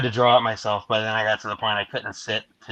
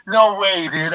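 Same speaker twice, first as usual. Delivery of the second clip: high, loud disbelief; retold amazed reaction; imitated amused friend; 8 semitones higher, 4 dB louder, slower than usual. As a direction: mimic a buddy cracking up over the mic. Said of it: "Jack's laughing on the other side of the mic going".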